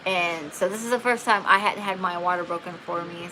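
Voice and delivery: ordinary talk, nothing marked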